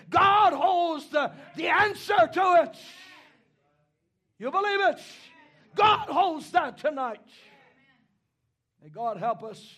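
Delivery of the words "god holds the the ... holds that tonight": high, loud preacher's shout; full-force preaching. Acted. Preacher assuring the congregation God holds the answer, full-force preaching. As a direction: ring it out to the congregation.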